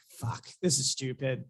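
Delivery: retold low grumble under the breath; muttered aside. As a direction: mutter it softly to yourself, half-whispered. Retells his muttered reaction mid-match.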